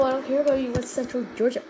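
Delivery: ordinary talk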